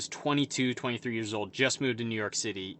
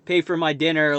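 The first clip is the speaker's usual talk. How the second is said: retold berating; 5 semitones higher, 5 dB louder than usual. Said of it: "berating me about".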